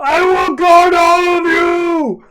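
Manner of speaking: high, loud, slow heroic cry; heroic shout. Acted. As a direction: bellow the vow to the skies. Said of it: "He's like".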